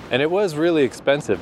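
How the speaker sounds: ordinary talk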